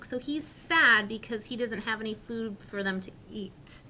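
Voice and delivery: ordinary delivery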